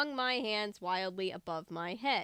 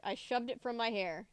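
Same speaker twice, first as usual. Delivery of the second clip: usual speaking voice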